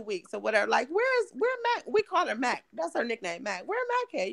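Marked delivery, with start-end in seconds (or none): 0.9-1.8 high worried calling voice; asking where Mac is. Retold or imitated. Family member asking where Mac went. 3.7-4.3 high worried calling voice; asking where Mac is. Retold or imitated. Family member asking where Mac went.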